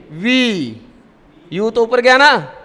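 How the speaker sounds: usual speaking voice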